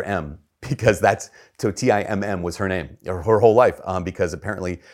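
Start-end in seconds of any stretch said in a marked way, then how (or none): none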